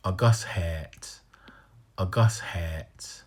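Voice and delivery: A Gus hat whispered slowly; whispered slow demo. Shown as a demonstration. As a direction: breathe A Gus hat, stressing Gus. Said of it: "You got that lengthened hat".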